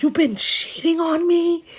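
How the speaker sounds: high, incredulous mock confrontation; mock outraged accusation. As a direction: act out catching a cheater. Acted accusation to cheating boyfriend.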